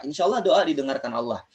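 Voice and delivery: usual speaking voice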